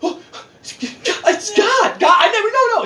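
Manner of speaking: high, mock innocent pleading; squeaky fake denial; Mary's excuse. Mary pleading innocence to Joseph, high mock voice. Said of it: "now her story is".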